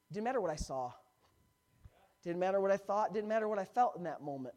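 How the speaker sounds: rising sermon emphasis; preaching build. Real, not acted. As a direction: drive each line home from the pulpit.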